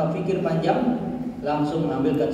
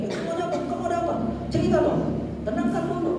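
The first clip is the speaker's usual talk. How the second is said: much higher, flustered questions to a panting friend; startled higher-pitched questions at someone out of breath; startled fussing; 13 semitones higher, 3 dB quieter, much faster than usual. Retold or imitated. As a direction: play the panicky friend who adds to the fuss. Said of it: "jangan kita kaget-kaget".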